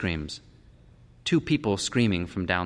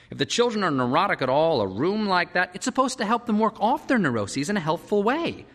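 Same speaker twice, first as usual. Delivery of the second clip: higher-pitched, animated parent in debate; worried parent; 11 semitones higher, 4 dB louder than usual. A narrator's reading. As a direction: argue the point in a lifted voice.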